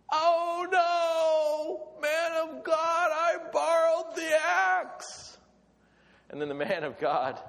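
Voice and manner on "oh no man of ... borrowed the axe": high, slow, shouted wail of alarm; panicked cry. Acted. Prophet's student losing the borrowed axe, shouting in panic. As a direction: howl it out like you've just lost something borrowed. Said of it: "and the guy goes".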